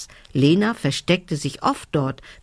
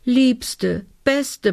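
high, imploring elf voice; pleading; 7 semitones higher than usual. A narrator's reading. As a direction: beg sweetly, pitched well up. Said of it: "bat sie".

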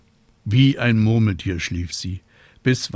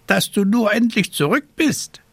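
raven voice; 13 semitones higher, 6 dB louder than usual. A narrator's reading. Raven greets returning witch.

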